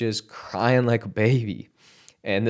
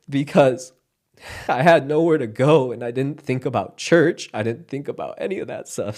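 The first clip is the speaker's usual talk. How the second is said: getting emotional; 4 semitones higher than usual. Real, not acted. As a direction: voice rising with real emotion. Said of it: "I'm getting so emotional".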